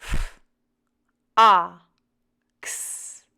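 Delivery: F, A, X as separate sounds, pauses between; sounding out. Shown as a demonstration. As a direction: say F, A, X as sounds, not names. Sounding out a word to blend.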